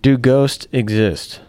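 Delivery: very slow, drawn-out game-show question; dramatic slow question. Acted. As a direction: stretch every word for suspense. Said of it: "You're on the hot seat".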